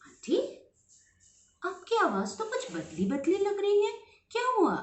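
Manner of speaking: put-on puzzled girl. Girl doubts changed voice.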